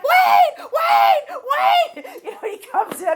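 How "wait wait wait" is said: high, much louder frantic yelling of wait; retold panic as a high frantic shout; panicked shout. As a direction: shriek it like your hair is on fire. Hair on fire, panicking.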